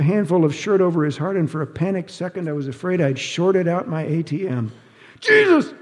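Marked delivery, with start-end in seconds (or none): 5.2-5.7 sudden high, loud squawk. Acted. Shown a gun, the ex-husband squawks high and loud. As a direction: yelp it out high in panic. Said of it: "he squawked".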